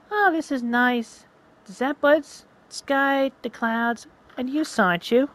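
put-on a girl sighing over a perfect moment; dreamy girlfriend.

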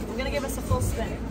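usual speaking voice

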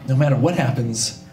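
low, earnest declaration; low-pitched heartfelt goodbye, hammed-up; solemn mock farewell. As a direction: say it gravely, like last words to a best friend. Solemn farewell before being caught.

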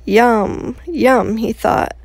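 put-on reading to children. Outdoor reading to children, animated storytelling. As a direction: tell it like a bedtime story.

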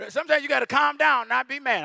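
ordinary delivery

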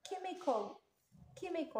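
"chemical" with its ch said as a hard k; ch pronounced as k. Shown as a demonstration. Teacher showing pupils how ch is read, "chemical" with a k. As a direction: say "chemical" opening on a k sound. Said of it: "بصح ننطقوها ك".

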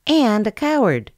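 a sniffy little jab; scornful sniff. A narrator's reading. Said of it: "sniffed Woof Woof".

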